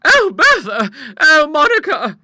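very high, loud wail of alarm; high-pitched panicky exclamations; frightened gasp. A narrator's reading. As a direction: cry out in exhausted horror. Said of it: "gasped the poor exhausted gentlewoman".

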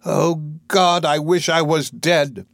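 high, slow anguished outburst; drawn-out wail of despair; despairing cry. Acted. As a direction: cry out in misery. Eliza despairing, hides her face.

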